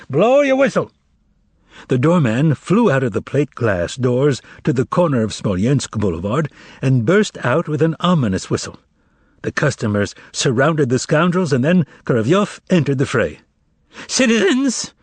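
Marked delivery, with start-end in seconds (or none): 0.1-0.9 high commanding call; sharp order. Acted. Manager ordering the doorman to whistle, high and commanding. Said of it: "gave the order". 14.1-14.9 high quavering call; rally the crowd with a thin quaver; thin tremulous shout. Acted. Korovyov appealing to the customers, thin and high. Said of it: "shouted in a thin, tremulous voice".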